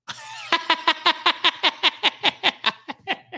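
high, bursting genuine laugh; real laugh. Real, not acted. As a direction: crack up for real at the question. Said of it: "'cause that's a real laugh".

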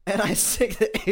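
high, loud laugh through the words. Real, not acted. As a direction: laugh at your own mistake. Laughing at own blunder.

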